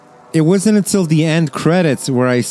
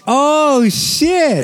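real surprise; 13 semitones higher than usual. Real, not acted. Cohost hears the actor's earlier role, surprised. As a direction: blurt it out, caught off guard.

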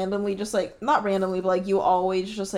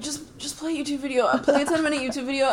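higher, rushed sleepy plea; sleepy pleading; 6 semitones higher than usual. Retold or imitated. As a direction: mumble it half asleep. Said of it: "You're like".

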